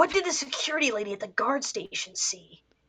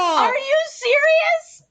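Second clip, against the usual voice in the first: very high, slow squeal of shock; 5 dB louder, slower than usual. Real, not acted. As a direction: shriek it in disbelief. Shocked delight at game.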